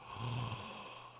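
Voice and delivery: breathy shocked gasp; whispered gasp. Real, not acted. Reacting to finding the clip.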